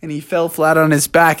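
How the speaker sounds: ordinary delivery, nothing marked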